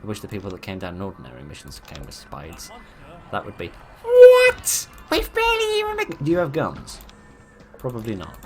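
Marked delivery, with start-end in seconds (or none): 3.3-7.0 game voice cuts in. Acted. Game unit voice overrides speech.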